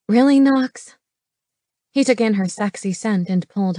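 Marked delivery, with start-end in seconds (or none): 0.1-1.0 high, loud exasperated woman; exasperated disbelief. A narrator's reading. Woman answering his crude advance, exasperated. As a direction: pitch it up in protest.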